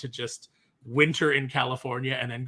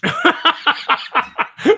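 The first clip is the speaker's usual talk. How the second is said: loud high laugh; 12 semitones higher, 11 dB louder than usual. Real, not acted. Host cracks up at the voice.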